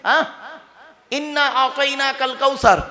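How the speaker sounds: ordinary delivery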